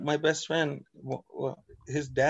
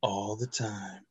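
low, soft, drawn-out echo; slow late-night radio put-on; podcast DJ voice; 6 semitones lower, 8 dB quieter, much slower than usual. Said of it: "talking like this for the podcast".

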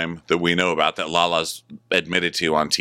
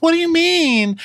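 very high, loud squeak of a question; high mocking squeak; mock high squeal; 6 dB louder than usual. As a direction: squeal it out in disbelief. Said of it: "He goes".

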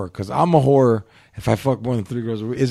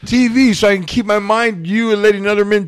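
higher-pitched, hammed-up demand; mock demand; 7 semitones higher, 4 dB louder than usual. Man imagines demanding a TV from his girlfriend, mock demanding.

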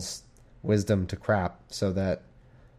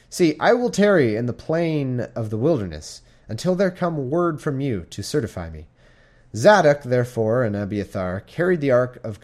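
scripture reading; 3 semitones higher than usual. Acted. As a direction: read it like the pulpit. Reading King James scripture aloud.

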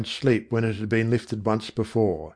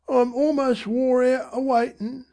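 high, hushed Cockney mother; mother's voice; 13 semitones higher than usual. A narrator's reading. Mother hushing Dicky to sleep, high and hushed. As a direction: soothe the boy in a tired mother's voice. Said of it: "Dicky heard his mother say".

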